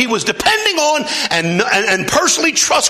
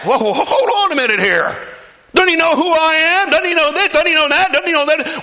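indignant, higher-pitched outrage; upset Naaman; 12 semitones higher than usual. Acted. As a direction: play the offended big man. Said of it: "Naaman got upset".

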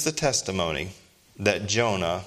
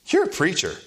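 a doctor's raised questioning voice, higher; doctor asking; 8 semitones higher than usual. Retold or imitated. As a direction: play the curious doctor, pitched up.

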